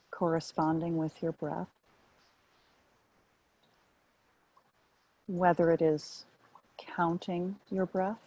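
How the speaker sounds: calm, measured meditation guidance with long pauses; guided meditation. Real, not acted. Meditation teacher settling listeners onto the breath as anchor, guided meditation. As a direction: ease them into stillness, one short phrase at a time.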